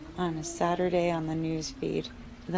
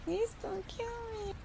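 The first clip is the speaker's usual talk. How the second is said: much higher, softer squeaky plea; tiny mosquito voice; 10 dB quieter than usual. Acted. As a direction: squeak like a little bug begging. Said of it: "they're always saying that to me".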